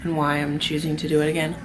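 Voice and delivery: talking while eating; words round a bite of food; mouth full. Real, not acted. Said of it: "Sorry, I'm eating".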